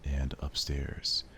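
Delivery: measured narrator voice; story narration. A narrator's reading. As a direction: set the scene calmly. Narration of visual novel.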